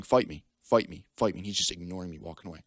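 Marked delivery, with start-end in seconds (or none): 0.0-1.4 short, loud challenge; retold taunt. Kid goading another who ignores him, loud taunt. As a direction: call out a repeated dare to fight.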